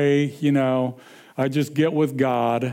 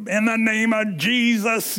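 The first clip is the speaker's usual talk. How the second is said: higher-pitched re-enacted rebuke; commanding prayer; 6 semitones higher than usual. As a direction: invoke it as if driving off evil. Re-enacts fighting fear with prayer.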